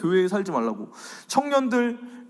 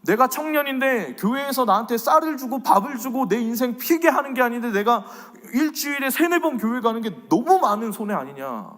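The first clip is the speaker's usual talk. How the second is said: lightly voiced complaining young churchgoer; mock grumble of a youth weighing the cost; voicing the youth; 6 semitones higher than usual. Preacher voicing a young member's complaint, mild grumble. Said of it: "시대적인 흐름은 이렇게 이야기합니다".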